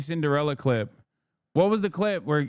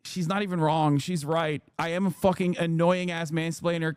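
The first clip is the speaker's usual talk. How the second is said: ordinary talk, nothing marked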